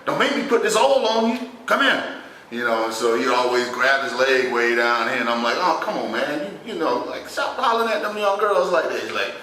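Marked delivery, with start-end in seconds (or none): 0.1-2.0 put-on very high, excited catcalling old man; old cat hollering. Uncle calling young girls over to him, hammed-up old cat. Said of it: "you know the old cat be like". 5.6-9.0 mild exasperated pleading. Retold or imitated. Nephew pleading with embarrassing uncle.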